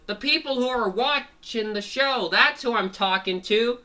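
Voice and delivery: high, loud cartoon comeback; emphatic retort. Fango answering the rabbit's challenge, emphatic retort. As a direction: fire back as the exasperated fighter.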